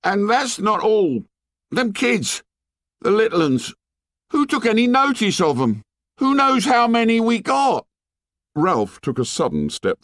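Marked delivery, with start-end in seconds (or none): 0.0-7.8 put-on high, accusing boy's voice; angry tirade. A narrator's reading. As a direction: berate them, rising to a high shout. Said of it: "Piggy lost his temper".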